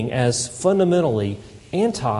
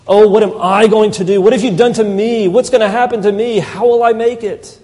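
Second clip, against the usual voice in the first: higher, louder mock panic; self-pitying wail; 6 semitones higher, 7 dB louder than usual. Preacher voicing a complainer's self-pity, loud. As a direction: panic over your own troubles, played up.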